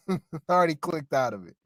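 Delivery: laughing. Real, not acted. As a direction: chuckle while repeating the line.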